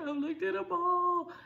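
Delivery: put-on high, slow little-girl whine; high sulky child voice, despairing over shirts; whiny child. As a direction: drag it out like a pouting kid. Voicing crying granddaughter.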